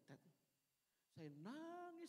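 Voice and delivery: ordinary talk, nothing marked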